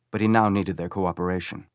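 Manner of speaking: usual speaking voice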